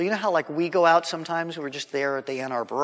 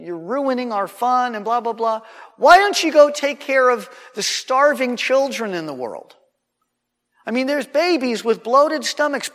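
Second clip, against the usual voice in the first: retold yelling; 6 semitones higher, 5 dB louder than usual. Young guy yelling at a girl in public, mock angry tirade. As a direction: rail at the church crowd, imitating. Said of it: "here's what he was yelling at her".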